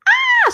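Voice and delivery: sudden high shriek of joy, very loud; excited scream. Retold or imitated. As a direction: let out a mock squeal of delight. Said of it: "So Angela's like".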